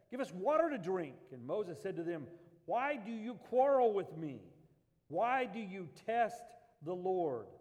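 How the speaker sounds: scripture read with mounting force; dramatic scripture reading. Acted. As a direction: read it like the crowd is shouting.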